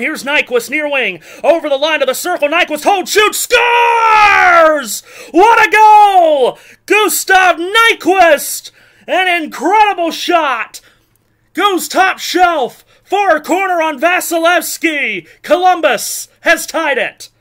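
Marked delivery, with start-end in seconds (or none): 1.4-8.7 high, loud, stretched goal yell; long goal yell. Real, not acted. Live goal call, Columbus ties. 9.1-17.3 excited. Real, not acted. Excitement after the goal.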